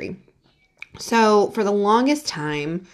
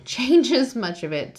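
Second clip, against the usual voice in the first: usual speaking voice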